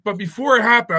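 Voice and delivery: normal talking voice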